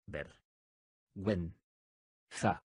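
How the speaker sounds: "Ver. Guen. Za." each syllable spoken apart with a pause. Shown as a demonstration. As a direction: split "Vergüenza" into separate syllables. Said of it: "dila silábicamente conmigo". (separando sílabas: "Ver. Guen. Za.")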